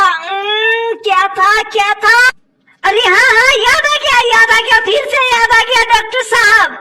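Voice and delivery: ordinary delivery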